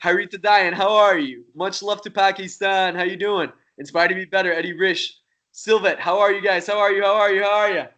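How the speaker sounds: greeting live viewers one by one; shout-outs. Real, not acted. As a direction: call out names warmly and brightly. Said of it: "Shouting out some people".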